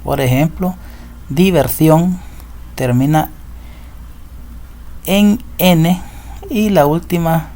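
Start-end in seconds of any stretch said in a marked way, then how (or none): 1.3-2.2 diversión with the weight on SIÓN; diverSIÓN, set apart as an example; final-syllable stress. Shown as a demonstration. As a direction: say diversión higher, landing on its last syllable. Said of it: "la sílaba tónica está en la última sílaba".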